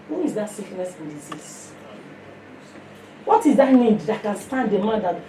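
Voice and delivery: ordinary talk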